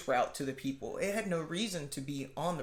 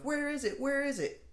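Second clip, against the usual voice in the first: much higher urgent asking; high frantic questions; 10 semitones higher than usual. Retold or imitated. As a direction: shout out looking around. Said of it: "who's like".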